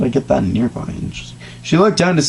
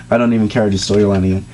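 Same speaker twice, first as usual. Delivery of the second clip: usual speaking voice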